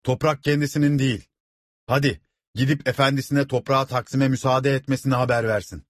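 shouted argument. A narrator's reading. Villager urges the crowd to send word, calling out. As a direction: argue it out to the whole crowd. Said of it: "diye bağırdı bir köylü".

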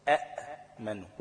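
أأمنوا said with two hamzas, slowly; original hamza form. Shown as a demonstration. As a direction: sound both hamzas in أأمنوا. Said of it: "كان أصلها إيه؟". (أأمنوا بهمزتين واضحتين ببطء)

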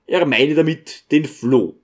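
usual speaking voice

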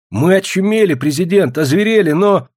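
high, loud, carried-away outburst; excited exclamation. A narrator's reading. Student blurts out to the president, excited exclamation. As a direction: exclaim it hotly at a high pitch. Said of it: "воскликнул студент".

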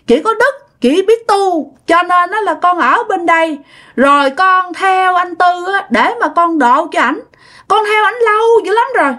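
put-on high, tearful toddler voice; crying child voice. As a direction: cry it out like a devoted little child. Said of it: "Nói mà khóc nha".

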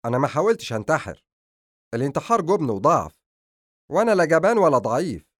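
loud, edgy retort with rising force; angry, emphatic denial; irritated. A narrator's reading. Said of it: "أجابها أدهم بعصبية".

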